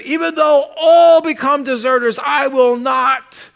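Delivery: shouted pledge of loyalty; emphatic declaration. Acted. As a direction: proclaim it like a zealot, slowing on the last words. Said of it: "declares emphatically his allegiance".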